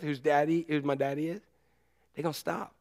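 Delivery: ordinary talk, nothing marked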